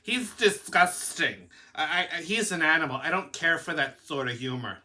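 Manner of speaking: put-on voice of a disapproving woman; imitated prim disapproval; mock offended woman. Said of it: "you know how they are".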